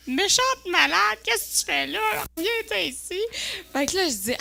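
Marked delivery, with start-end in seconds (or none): 0.1-3.2 mock scolding mother. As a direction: scold like an alarmed mother, playfully. Imite sa mère qui la gronde. (mère qui gronde, voix aiguë)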